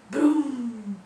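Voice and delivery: big loud booms; loud booming. Acted. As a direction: boom it out loudly. Booming sound review.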